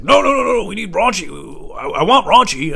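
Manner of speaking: high, loud, put-on owner voice; owner insisting.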